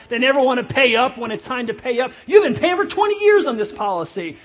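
loud, high-pitched grievance; indignant rant. Acted. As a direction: build into an aggrieved customer's complaint. Mock rant at insurance companies.

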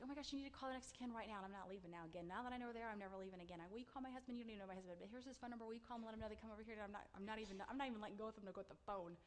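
rapid-fire breathless demands; acted-out urgent rambling; retold frantic insistence. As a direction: rattle it off without letting anyone interrupt. Acts out frantic demands to director.

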